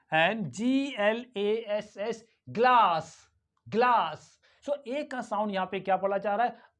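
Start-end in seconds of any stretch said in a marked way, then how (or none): 2.6-4.3 GLASS as 'glahs', long open vowel; British long A. Shown as a demonstration. Teacher modelling British English, long-ah GLASS. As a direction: say glass with the A of father. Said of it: "आ पढ़ा जा रहा है".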